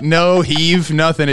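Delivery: normal talking voice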